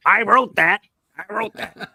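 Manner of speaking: high bragging, laughing at the end; Stan Lee. Retold or imitated. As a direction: boast about it, cracking up. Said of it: "you could also say".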